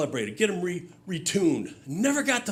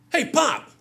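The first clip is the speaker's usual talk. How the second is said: teasing call; 10 semitones higher, 5 dB louder than usual. Retold or imitated. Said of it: "So I say to my dad".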